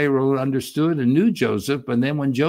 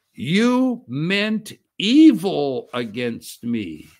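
very slow, every word laid down, weight on "evil"; slow emphatic quote; 3 semitones higher, 3 dB louder, much slower than usual. Shown as a demonstration. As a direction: read "you meant evil against me" slowly, with weight on "evil". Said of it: "here's the statement".

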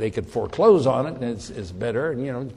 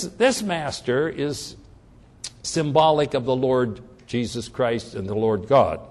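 ordinary talk, nothing marked

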